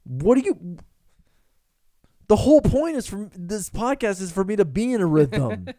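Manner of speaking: high, loud exasperated whine; hammed-up indignant complaint; mock outrage. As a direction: play up being thrown off.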